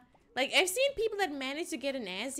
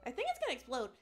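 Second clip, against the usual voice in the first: normal talking voice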